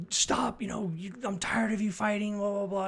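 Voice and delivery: high, exasperated scolding, imitating a fed-up parent; mock parental scolding. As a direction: play the tired parent snapping at the kid. Parents scolding a crying boy.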